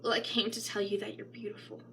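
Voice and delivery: fast soft whisper; a whispered compliment to a weary woman; whispered tenderness. Acted. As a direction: breathe it to her gently. Tender quoted reassurance.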